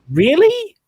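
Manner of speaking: high, loud disbelieving really; surprised. Retold or imitated. Realizing who voices Bo Peep, a high surprised really. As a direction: squeak out the surprise.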